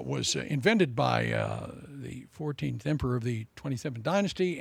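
ordinary talk, nothing marked

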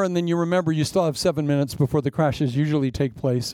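ordinary delivery

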